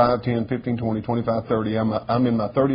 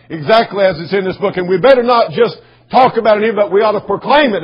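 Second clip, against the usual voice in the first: strong, raised preaching voice with punched key words; forceful preaching; 5 semitones higher, 7 dB louder than usual. Real, not acted. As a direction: press the charge on the congregation.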